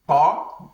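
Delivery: "Ta" with a heavy t, pushed from the throat; heavy, throaty "Ta". Shown as a demonstration. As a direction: say "Ta" as an emphatic Arabic letter. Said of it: "it is heavy from the throat".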